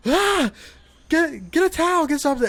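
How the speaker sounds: high, loud frantic orders; panicked shout. Retold or imitated. Panicked instructions to wife.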